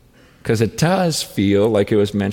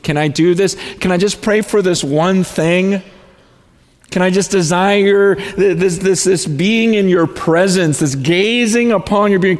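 fervent prayer; 10 semitones higher, 6 dB louder than usual. Retold or imitated. Acting out his own fervent prayer.

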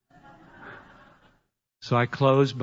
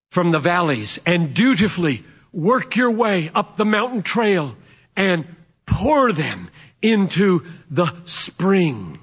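ordinary talk

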